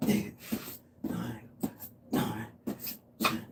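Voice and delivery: soft, short counts on the effort; hushed rep count. Real, not acted. Trainer counting reps mid-exercise, hushed. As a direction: count reps quietly under your breath.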